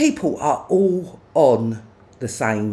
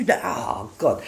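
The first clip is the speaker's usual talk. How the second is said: usual speaking voice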